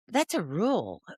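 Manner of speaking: ordinary talk, nothing marked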